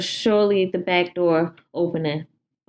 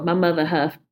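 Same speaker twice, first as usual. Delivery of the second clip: ordinary delivery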